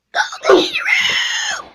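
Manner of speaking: high, strained breathy screech; pterodactyl shriek. Acted. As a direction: shriek it out like a flying reptile. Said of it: "I'm gonna be saying stuff like this".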